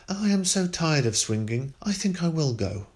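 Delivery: put-on a girl putting on a brave, careless tone; feigned calm. A narrator's reading. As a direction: pretend not to be frightened. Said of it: "to show them she was not frightened".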